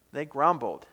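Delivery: ordinary delivery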